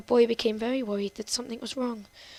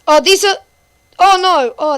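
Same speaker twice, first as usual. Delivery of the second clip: loud, high dismayed cry; recoil, loud and high; 6 semitones higher, 14 dB louder than usual. Real, not acted. Reacting to the sad stories.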